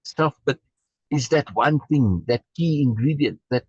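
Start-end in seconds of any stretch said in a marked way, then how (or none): none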